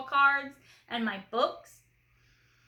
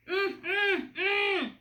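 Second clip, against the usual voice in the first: gagged mumble; 8 semitones higher, much slower than usual. Acted. Little sister gagged with a sock, trying to talk, muffled mumbling. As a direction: mumble hard through a sock stuffed in the mouth. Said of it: "took the sock out of her mouth".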